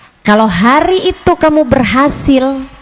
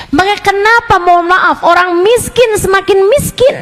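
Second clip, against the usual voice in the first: fiery preaching; 6 semitones higher than usual. Acted. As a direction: drive the rebuke home to the congregation. Preaching with rising force.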